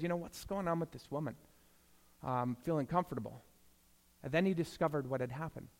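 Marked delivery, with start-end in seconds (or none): 0.0-3.4 much softer, leaned-in question; hushed aside. Retold or imitated. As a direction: murmur it to the pastor beside you. Pastor leans over to colleague.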